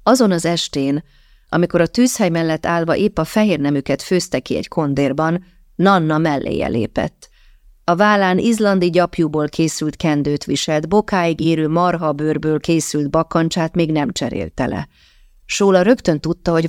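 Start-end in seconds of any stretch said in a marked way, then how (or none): none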